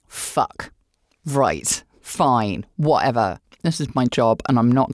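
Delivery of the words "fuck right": ordinary delivery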